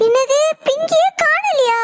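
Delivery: a mother hit by bad news; shocked. Mother hears her daughter went missing, shocked.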